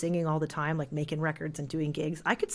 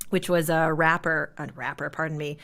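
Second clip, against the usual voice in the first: ordinary talk, nothing marked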